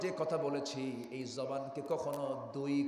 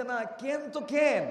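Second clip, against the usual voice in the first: slow, high mock Noakhali phrasing; Noakhali dialect; 8 semitones higher, 7 dB louder, slower than usual. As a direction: drawl it teasingly in Noakhali dialect. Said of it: "এ কেনই হলো ওদের ভাষা".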